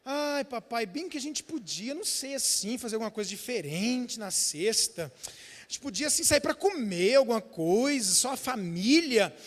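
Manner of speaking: put-on soft, sweet coaxing little-boy voice; coaxing child. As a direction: plead gently like a child angling for an outing. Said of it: "com esse jeitinho dele". (voz manhosa de criança pedindo com jeitinho)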